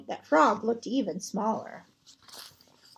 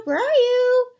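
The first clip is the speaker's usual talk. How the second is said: much higher, louder call; 10 semitones higher, 8 dB louder than usual. Acted. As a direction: call into the dark for a friend. Toad calls out for lost Frog.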